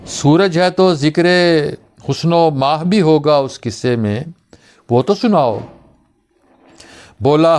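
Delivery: measured Urdu verse recital with lilting lines; mushaira-style poetry reading; poem recitation. Acted. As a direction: recite the verse, pausing after each line.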